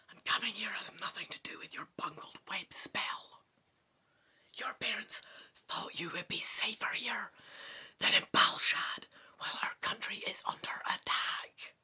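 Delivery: soft, sharp, fast unvoiced whisper. A narrator's reading. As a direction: hiss the warning so no one overhears. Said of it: "whispers sharply".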